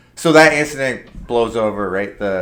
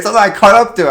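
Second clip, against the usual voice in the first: normal talking voice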